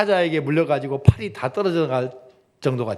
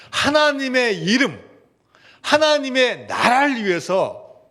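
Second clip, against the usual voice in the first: slow, louder, stressing 하나님의 each time; emphatic prayer; 8 semitones higher, 4 dB louder, slower than usual. Acted. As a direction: lean hard on 하나님의 as you plead.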